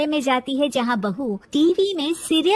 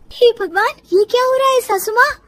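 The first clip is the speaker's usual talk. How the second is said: high, louder exclamation; astonished, rising cry; shocked daughter-in-law; 9 semitones higher, 4 dB louder than usual. Acted. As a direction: gasp out the question in amazement. Daughter-in-law shocked by frozen mother-in-law.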